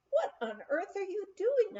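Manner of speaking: high, startled cry; startled question. Retold or imitated. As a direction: jump at the sight of him. Finds a man crawling at night.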